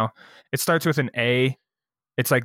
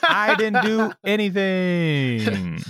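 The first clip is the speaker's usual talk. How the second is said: slow, higher-pitched mock protest; 6 semitones higher, much slower than usual. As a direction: drag it out as a hammed-up denial. Defensive DJ bit, drawn out.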